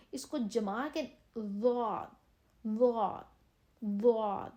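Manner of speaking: ضاد pressed firm, thick and held; ضاد heavy, not like dal; firm daad. Shown as a demonstration. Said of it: "جما کے اس کو نکالنا ہے".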